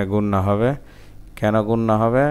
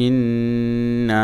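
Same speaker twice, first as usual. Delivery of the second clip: joined recitation; much faster than usual. Shown as a demonstration. Teacher putting the word back together, joined chant. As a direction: link it all, holding the long sounds.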